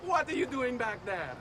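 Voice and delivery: high, laughing call to someone lagging behind; teasing call back. Acted. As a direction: tease him for falling behind. Companion calling back, teasing laggard.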